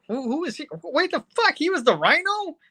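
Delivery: high-pitched disbelieving questions; mock shock at finding out; shocked disbelief. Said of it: "It's like".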